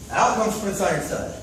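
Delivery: normal talking voice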